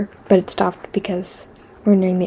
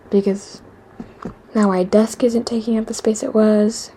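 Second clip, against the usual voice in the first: ordinary delivery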